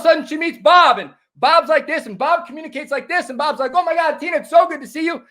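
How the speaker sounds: very high, rushed, gushing voice; over-the-top excited. Shown as a demonstration. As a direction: gush at Tina way too high and eager. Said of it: "talk to you like this".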